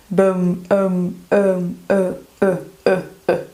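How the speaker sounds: low, neutral "uh" peeled out of "bum"; isolated schwa. Shown as a demonstration. Teacher isolating the schwa for learners, short low uh. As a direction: drop to a relaxed low "uh". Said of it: "esse é o som do schwa".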